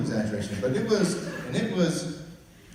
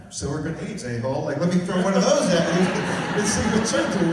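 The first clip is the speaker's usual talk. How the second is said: louder, laughing retort; laughing comeback; 6 semitones higher, 8 dB louder than usual. Retold or imitated. Host giving his comeback to teasers, laughing retort. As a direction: fire back with a laugh. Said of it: "same comeback every time".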